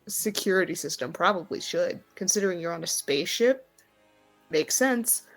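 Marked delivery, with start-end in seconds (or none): none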